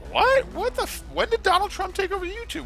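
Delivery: very high-pitched, acted-out bafflement; shocked disbelief. Streamer retelling their reaction to the YouTube news, shocked disbelief. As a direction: squeak out your astonishment, hammed-up.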